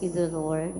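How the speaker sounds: ordinary delivery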